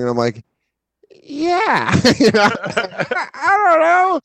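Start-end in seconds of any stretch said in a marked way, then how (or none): 1.2-2.5 a much higher, drawn-out guilty yeah; sheepish yeah. Retold or imitated. Admitting to his girlfriend he kept chatting, sheepish and high. Sheepish admission in retold argument. 3.3-4.2 much higher, squeaky defensive shrug; exasperated. Real, not acted. Defending himself to friends over the messages, high and exasperated.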